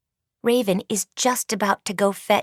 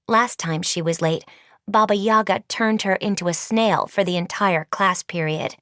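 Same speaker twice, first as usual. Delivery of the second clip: ordinary delivery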